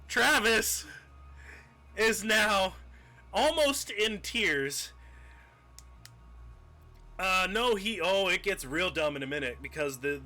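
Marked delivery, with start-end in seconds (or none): none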